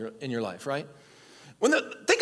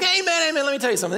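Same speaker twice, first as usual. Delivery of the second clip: high, loud "amen, amen"; punchy mock interjection; imitating Jesus' insistent comeback; 7 semitones higher, 4 dB louder, much faster than usual. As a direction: playfully voice a teacher jumping in over confusion. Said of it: "He's like".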